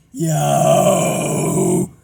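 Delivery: airy rough growl, voice taken out; voiceless false chord. Shown as a demonstration. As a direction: growl on air only, no voice. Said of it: "take out the voice".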